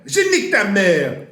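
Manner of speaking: je nique ta mère yelled high and loud; shouted insult. Shown as a demonstration. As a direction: hurl "Je nique ta mère" like a real fight insult. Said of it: "Okay, je nique ta mère".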